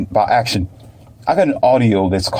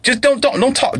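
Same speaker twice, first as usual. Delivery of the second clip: high urgent plea. Retold or imitated.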